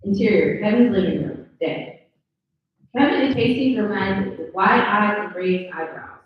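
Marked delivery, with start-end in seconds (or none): none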